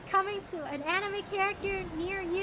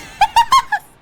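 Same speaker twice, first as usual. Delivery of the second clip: high loud cackle; shrieking laugh; burst of laughter; 16 dB louder than usual. Real, not acted. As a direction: crack up loudly. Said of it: "no silly noises".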